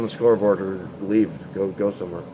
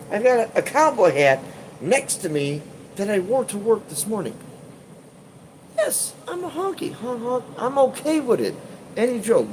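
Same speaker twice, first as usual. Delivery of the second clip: silly clown; 11 semitones higher than usual. Acted. Mock self-roast as cowboy honky.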